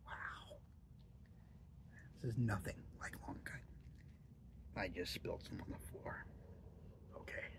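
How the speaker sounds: low breathy whisper. Real, not acted. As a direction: whisper under your breath. Hushed asides while handling snuff.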